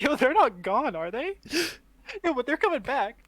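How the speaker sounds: high, loud, exaggerated worry; mock concern. Teasing the worried co-host about vanished weapons, mock-concerned. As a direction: plead in hammed-up alarm. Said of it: "Why do you sound concerned?".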